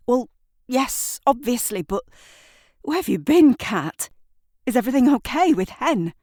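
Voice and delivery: high and pressed, words tumbling fast; agitated. Acted. Shirley pressing Kat about Hen, agitated and rushed. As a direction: fire the questions back in a rush. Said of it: "Shirley says".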